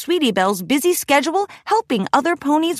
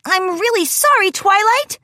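put-on high young filly voice, apologetic; sorry little pony, pitched high; Sweetie Belle; 10 semitones higher than usual. Young filly apologizes after collision.

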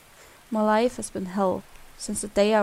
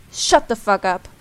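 angry snap; 3 semitones lower, 6 dB louder than usual. Acted. Snaps at the pleading victim.

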